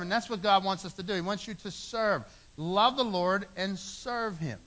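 usual speaking voice

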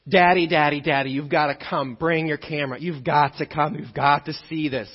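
put-on urgent, breathless little-boy plea; pleading excited child. Son begging dad to come.

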